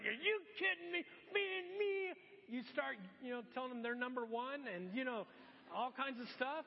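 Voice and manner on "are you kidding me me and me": higher, louder disbelieving outburst; incredulous road-rage cry, pitched up and loud; exasperated outburst. Retold or imitated. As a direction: blow up at a bad driver in mock outrage. Frustrated driver in traffic.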